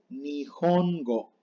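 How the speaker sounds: "nihongo" as slow ni-jon-go; sounded Japanese H. Shown as a demonstration. Teacher reads word for japonés, "nihongo" slowly with sounded H. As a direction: break "nihongo" into syllables, h like jota. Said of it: "Se lee nihongo".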